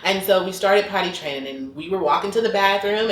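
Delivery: usual speaking voice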